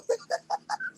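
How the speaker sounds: high thin squeal of laughter. Real, not acted. Finishing the story, high squeal.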